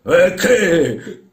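high, loud laughing retort; peculiar laugh. Acted. Old master laughing at the workman, odd chuckle. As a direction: laugh through the short question. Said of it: "rise, in un suo modo speciale".

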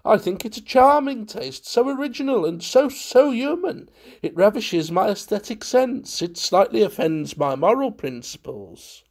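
very high, effusive aesthete; gushing guest. A narrator's reading. Valentine praising the charming taste, high gushing. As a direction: gush it high and lively, savouring each phrase. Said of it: "cried Valentine".

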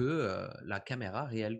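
normal talking voice

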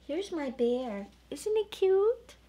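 plain reading voice

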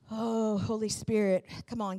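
ordinary talk, nothing marked

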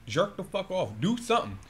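ordinary delivery

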